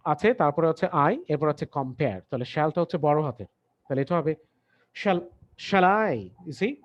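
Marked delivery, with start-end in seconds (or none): 5.0-6.1 "shall" drawn out and rising, "I" light; stress on shall. Shown as a demonstration. Teacher showing students the stressed first word, slow and emphatic. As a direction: lean hard on "shall", let "I" fall away. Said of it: "শ্যালটাকে emphasis দিতে হবে".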